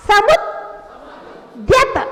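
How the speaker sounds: "समुद्यत" split as samud, held silence, dyat; very slow demonstration of "समुद्यत" with a dead stop inside; one-second pause mid-word. Shown as a demonstration. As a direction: hold the d of "समुद्यत" a second, then release. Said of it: "एक second उसी मुद्रा में ठहर जाइए".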